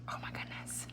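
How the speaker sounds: soft breathy whisper of dismay. Real, not acted. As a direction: whisper it under your breath. Surprised at spill, whispering.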